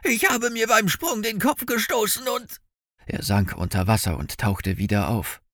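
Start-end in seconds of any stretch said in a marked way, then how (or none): 0.0-2.6 high, strained, desperate voice; panicked gasping. A narrator's reading. Injured Jenkins explains while drowning, panicked. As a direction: strain high, struggling to stay afloat. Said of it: "keuchte Jenkins dunkle Gestalt".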